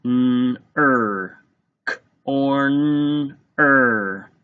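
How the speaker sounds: "n, er", then "K, or, n, er", every sound held apart. Shown as a demonstration. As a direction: segment "K, or, n, er" slowly. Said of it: "Tap it out with your fingers".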